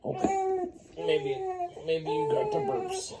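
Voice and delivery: voce acutissima da mamma che coccola il neonato. Real, not acted. Parent coos to the baby.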